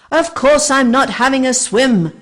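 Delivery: high, loud exasperated yell; soaked raptor yelling back. A narrator's reading. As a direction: bark it back crossly. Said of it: "yelled Vic".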